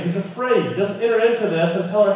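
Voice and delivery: ordinary talk, nothing marked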